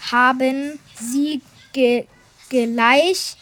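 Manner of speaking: usual speaking voice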